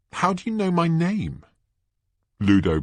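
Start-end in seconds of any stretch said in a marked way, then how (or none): 0.1-1.4 put-on high, startled girl; young Hermione, suspicious. Girl asking strangers about her name, high and suspicious. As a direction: demand it in a high voice.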